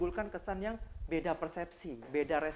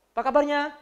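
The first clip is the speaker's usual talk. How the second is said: upbeat greeting; 6 semitones higher, 8 dB louder than usual. Shown as a demonstration. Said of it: "Jangan ngomong baik tapi nadinya".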